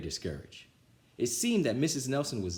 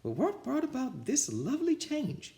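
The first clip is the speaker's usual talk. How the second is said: sweet teacher. A narrator's reading. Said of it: "she asked".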